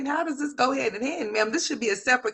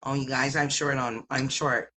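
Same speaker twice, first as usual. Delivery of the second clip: lower, mocking put-on voice of the tenant; mocking imitation; 8 semitones lower, 4 dB quieter than usual. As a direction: mimic her pleading, pitched low.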